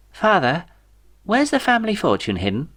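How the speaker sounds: high, stammering, halting heir; nervous young son. A narrator's reading. Kelvin asks about the money.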